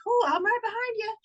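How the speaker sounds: retold eager call after the dog; eager agreement. Owner following dog home, eager high reply.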